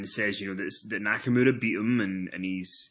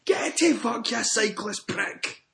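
much higher, slow hammed-up snarl; imitating an angry postman; 11 semitones higher, slower than usual. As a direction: spit the insult back at the cyclist. Postman retorts in mock voice.

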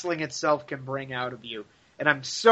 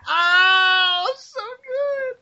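swooning; 3 dB louder, much slower than usual. Real, not acted. Swooning over the wedding.

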